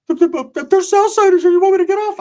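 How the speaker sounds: high, stammering worried voice; high-pitched nervous friend impression; Ricky. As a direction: stutter it out in a squeaky falsetto.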